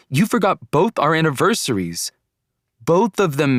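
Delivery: high, emphatic accusation; mad. A narrator's reading. Girlfriend scolds boyfriend for both forgotten anniversaries, mad. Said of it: "how mad she was".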